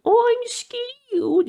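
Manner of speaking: very high, drawn-out mock squeal; exaggerated falsetto shriek of a scared child; monkey-like squawking child. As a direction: squawk it loud and slow like a panicking kid. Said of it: "He starts squawking, literally like a monkey".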